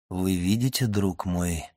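low, soft and controlled; composed, almost unchanged voice. A narrator's reading. Count to his friend after a violent inner struggle, composed and quiet. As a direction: speak quietly, keeping command of yourself. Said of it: "сказал он почти неизменившимся голосом".

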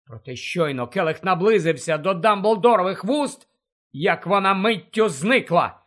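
usual speaking voice

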